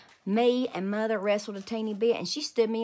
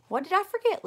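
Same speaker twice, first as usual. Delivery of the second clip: high, louder questioning, stress on I; puzzled grandpa; 11 semitones higher, 5 dB louder than usual. A narrator's reading. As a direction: rise up, curious and puzzled. Grandpa puzzled by child's hint.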